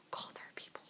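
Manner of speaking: breathy hushed aside; hushed panic; whisper. Real, not acted. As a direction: whisper it so nobody hears. Aside noticing people nearby.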